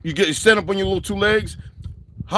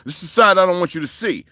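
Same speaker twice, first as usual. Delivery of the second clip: ordinary talk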